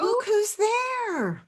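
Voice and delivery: high, slow sing-song reveal; playful reveal. Acted. Host to kids, revealing the hidden scribble, high playful. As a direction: draw out the surprise like a peekaboo.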